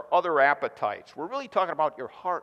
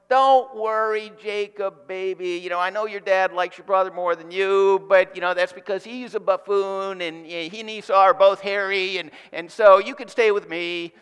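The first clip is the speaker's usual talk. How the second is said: a doting mom cooing over her son; mother soothing; 5 semitones higher, 8 dB louder than usual. Acted. Pastor voicing Rebekah comforting Jacob, doting mom.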